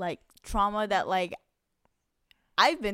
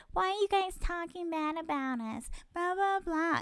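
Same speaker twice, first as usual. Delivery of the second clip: mocking whiny voice; 10 semitones higher, 3 dB quieter than usual. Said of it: "be like".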